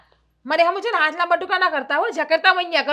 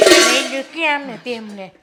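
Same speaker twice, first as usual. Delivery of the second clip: ordinary talk, nothing marked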